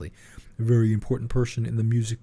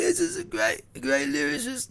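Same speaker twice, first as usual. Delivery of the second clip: much higher, put-on [name] voice; [name] impression; slower than usual. Doing [name] for his co-host, high and put-on. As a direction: squeak it out like [name]. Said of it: "just do your [name] impression".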